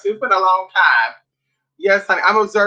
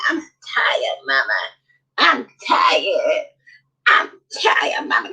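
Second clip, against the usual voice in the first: put-on breathy, whimpering little-kid cry of tiredness; whimpering tired child; 5 semitones lower, slower than usual. Sleepy child whimpering to Mama, mock crying. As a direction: whimper it like a worn-out toddler.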